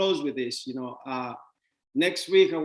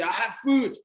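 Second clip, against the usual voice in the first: mock defensive saver; 6 semitones higher, 4 dB louder than usual. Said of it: "but you say".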